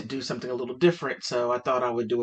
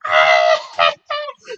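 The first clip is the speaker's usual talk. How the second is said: loud shrieking laugh, mimicked; imitated laughing fit; 11 semitones lower, 8 dB louder than usual. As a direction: burst out like a friend collapsing in laughter. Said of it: "she fell on the ground laughing".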